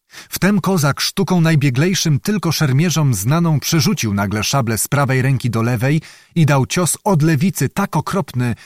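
ordinary delivery, nothing marked

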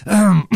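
loud preliminary "ahem"; throat clearing. A narrator's reading. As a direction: clear the throat before speaking up. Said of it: "Kapitan odkaszlnął".

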